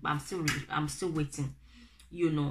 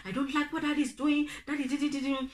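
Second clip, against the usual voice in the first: put-on childish tattling; 8 semitones higher than usual. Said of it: "I'll report my daddy".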